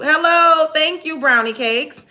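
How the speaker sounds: high, loud hello; loud callout. Real, not acted. Calls out mid-rant to the chat.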